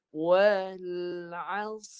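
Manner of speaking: Wal as with a thick sad and a trilled ra; correct reading. Shown as a demonstration. Teacher modelling the correct recitation for the class. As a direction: read Wal as clearly, each letter separate. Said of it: "dengar saya baca".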